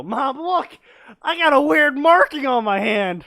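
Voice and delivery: put-on high, loud excited child; excited kid voice. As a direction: shout it like an eager kid. Kid calling to mom in story.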